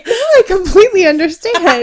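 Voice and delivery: high, loud laughing words. Real, not acted. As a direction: laugh through the line. Laughing mid mock argument.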